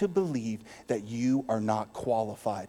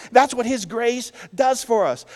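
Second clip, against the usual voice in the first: ordinary delivery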